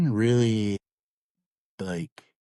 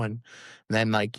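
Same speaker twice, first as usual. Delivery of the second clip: usual speaking voice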